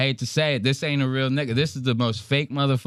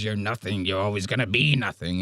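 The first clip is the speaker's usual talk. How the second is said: a hint of a villain in the head; Green Goblin, lightly voiced; 2 semitones lower, 3 dB quieter than usual. Green Goblin subconscious taunting him, lightly voiced. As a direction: lightly play the taunting inner demon. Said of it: "Green Goblin subconscious in his head telling him".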